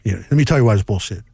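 Tony La Russa impression. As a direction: imitate the old manager leaving a grumpy voicemail, voice dropped low. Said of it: "That was a really good Tony La Russa impression".

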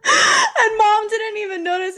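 high and loud, shrieking with laughter; crying laughing. Real, not acted. Telling her cohost about Mom, shrieking with laughter. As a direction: wail it out mid-laugh.

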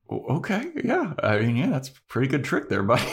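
mock-shaky vampire; shaken Spike. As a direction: laugh off the scare, rattled. Spike shaken after fake stake.

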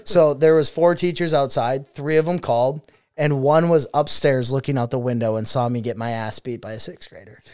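ordinary talk

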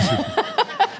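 sudden high burst of laughter. Real, not acted. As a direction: let out a quick high laugh. Laughs at own remark.

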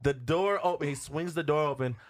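ordinary talk

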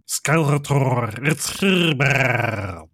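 slow, loud cartoon creature voice; drawn-out gargling cartoon villain; Merman. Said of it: "he reminds me of Merman from the He-Man cartoons".

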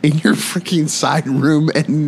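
high, drawn-out exasperated protest; mock outrage. Friend calling out a collectibles seller's denial, mock outrage.

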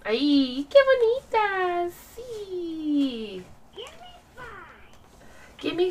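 high, soft, slow cooing. Real, not acted. Mother praises her pretty baby, cooing.

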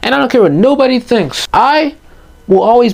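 impassioned rant. Real, not acted. Teen urging viewers that feelings are okay, impassioned and high. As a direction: pour it out, pleading and fired up.

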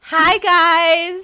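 playful voice for a hatchling guest; pretend chick guest. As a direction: greet the hosts as a little chick.

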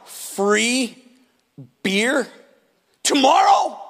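halting, voice lifting on tomorrow; lightly acted kid sounding out a sign; puzzled college kid. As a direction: read it out word by word in dawning wonder. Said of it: "he's like".

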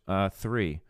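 ordinary talk, nothing marked

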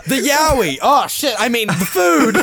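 loud, laughing correction. Real, not acted. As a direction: laugh through the slip-up. Laughing at his slip.

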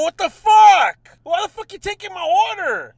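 loud, high angry shouting at the cashier; furious retold rant, pitched high; retold angry yelling. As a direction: yell it like you've lost patience. Retold angry yelling at drive-thru.

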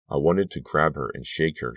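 plain reading voice

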